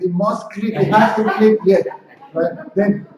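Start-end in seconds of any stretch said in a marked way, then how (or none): 0.0-1.9 higher, louder mock insistence; insistent voice of the mind. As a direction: play the mind demanding its result. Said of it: "and the mind says".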